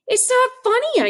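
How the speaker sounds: high, slow, hurt protest; boy protesting to his laughing mother, high and hurt; upset child. Retold or imitated. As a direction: whine it through tears.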